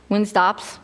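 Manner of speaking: usual speaking voice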